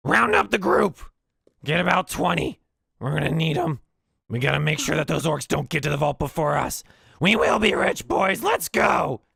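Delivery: high, loud battle cry; rallying goblin shout. Acted. Goblin boss rallying his goblins, high and loud. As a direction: whip the crew into motion. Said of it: "says in goblins".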